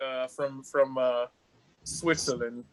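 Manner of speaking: low, halting fake Cockney; half-hearted Cockney introduction; put-on Cockney, fumbling for words; mock Cockney accent. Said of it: "I'm Cockney".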